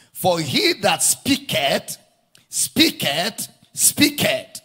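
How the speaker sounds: speaketh repeated slowly and emphatically; stress on speaketh. Shown as a demonstration. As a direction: hammer home each speaketh. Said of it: "Did you see the emphasis?".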